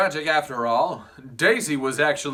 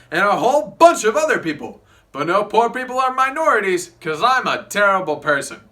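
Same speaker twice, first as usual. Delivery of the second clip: boorish Tom Buchanan; 5 semitones higher, 5 dB louder than usual. Acted. Plays Tom Buchanan throughout.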